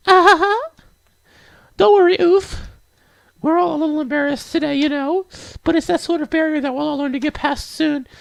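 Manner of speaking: put-on very high, reassuring girlish falsetto; high, sweet character voice; high-voiced game girl. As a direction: comfort them as a little anime girl.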